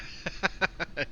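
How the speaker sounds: high, quick chuckle; real amused giggle; short chuckle. Real, not acted. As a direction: chuckle in agreement. Amused by viewer's comment.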